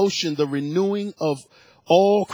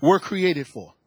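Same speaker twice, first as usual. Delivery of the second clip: fervent preaching; 7 semitones higher than usual. Real, not acted. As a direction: drive the point home to the congregation. Preaching at full force again.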